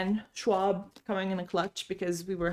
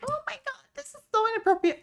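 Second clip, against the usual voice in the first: mock scandalized gasp; faster than usual. Imagined scandalized bystander.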